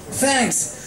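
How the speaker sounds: high, keen tomboy voice; Rainbow, fired up; Rainbow Dash. Acted. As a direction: boast about the holiday. Rainbow excited about holiday.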